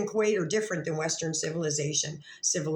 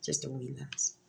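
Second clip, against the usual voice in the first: a light Scottish lilt, softer and lower; mock Scottish lass; 5 semitones lower, 7 dB quieter than usual. To viewers, joking about Scottish ancestry, in a light Scottish accent. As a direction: playfully put on a Scottish accent. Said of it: "I'm Scottish".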